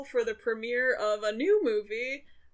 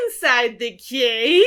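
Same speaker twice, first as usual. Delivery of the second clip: witch voice; 2 semitones higher, 13 dB louder, slower than usual. Pretend. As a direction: croon it like a witch. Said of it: "like a witch".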